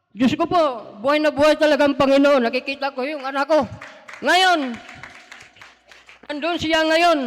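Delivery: loud joyful testimony of praise; fervent praise. Real, not acted. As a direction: proclaim it with joy.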